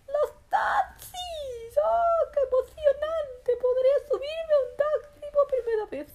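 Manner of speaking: high, loud squeal of excitement; thrilled. Acted. Rabbit thrilled at his first taxi ride, excited squealing. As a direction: burst out with childlike delight, stretching the first words.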